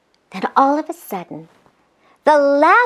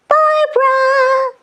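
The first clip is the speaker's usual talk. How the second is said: shouted call; 11 semitones higher, slower than usual. A narrator's reading. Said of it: "the babes shouted".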